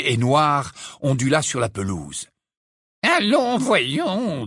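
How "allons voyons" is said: put-on high, wavering old-professor fretting; anxious. A narrator's reading. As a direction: fret mildly at the class. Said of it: "d'un ton anxieux".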